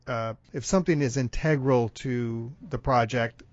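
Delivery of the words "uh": usual speaking voice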